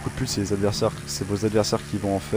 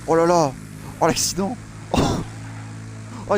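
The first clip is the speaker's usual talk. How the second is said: surprised outcry; 8 semitones higher than usual. Real, not acted. Watching a crash in game.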